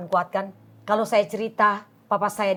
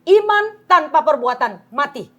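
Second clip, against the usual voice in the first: slow, loud, raised preacher's declaration; emphatic preaching; 6 semitones higher, 8 dB louder than usual. Real, not acted. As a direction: hammer it home like a preacher. Preaches a faith maxim.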